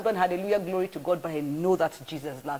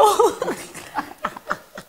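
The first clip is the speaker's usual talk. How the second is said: bright high burst of laughter; 3 dB louder than usual. Real, not acted. Laughing at her own story, a high real laugh.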